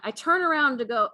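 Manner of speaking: usual speaking voice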